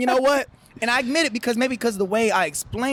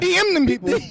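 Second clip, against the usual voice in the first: ordinary talk, nothing marked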